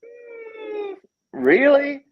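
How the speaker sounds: very high, drawn-out mock surprise on the hum, then a loud really; sarcastic disbelief. Hearing the fire chief blamed, high and sarcastic. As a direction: fake amazement, dripping with sarcasm. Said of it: "and I went".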